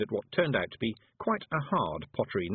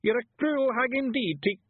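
very high, loud Scots accusation; outraged Feegle; 5 dB louder than usual. Acted. Rob Anybody accusing Miss Level, outraged. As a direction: cut off mid-outburst.

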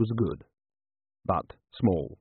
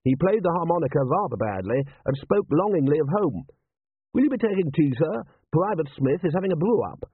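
higher-pitched, polite manservant; deferential butler; 7 semitones higher than usual. A narrator's reading. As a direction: move formally from eulogy to serving tea. Butler figure in narrated story.

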